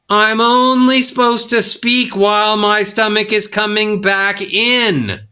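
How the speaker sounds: high, sustained, drawn-out line; one long exhale. Shown as a demonstration. Coach demonstrating breathing, sustained on one breath. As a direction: hold "in" long on the outgoing breath.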